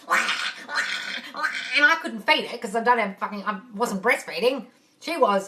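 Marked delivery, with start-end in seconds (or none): 0.1-1.5 imitated baby cry. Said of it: "holding this baby going".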